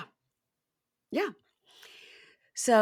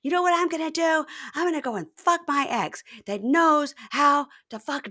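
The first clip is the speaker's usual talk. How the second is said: raised-pitch taunting threat; acted threat; 6 semitones higher, 3 dB louder than usual. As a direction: taunt him as the vengeful girlfriend. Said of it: "She says".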